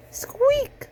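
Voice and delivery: mouse squeak. A narrator's reading. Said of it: "said Mouse".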